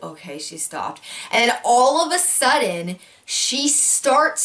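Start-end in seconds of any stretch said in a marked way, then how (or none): 0.0-1.0 much softer, hushed relief; relieved hush. Retold or imitated. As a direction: breathe it out quietly in relief. Baby doll suddenly goes quiet.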